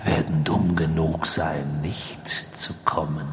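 slow, hissing villain whisper; whispering dark lord. A narrator's reading. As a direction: gloat in a cold whisper. Villain voice held in reading.

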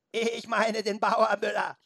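high, slow, drawn-out bleating goat voice. A narrator's reading. Goat mocks the humans who penned it in, bleating. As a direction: stretch and wobble the vowels like a goat. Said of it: "mit ihrer meckrigen Stimme".

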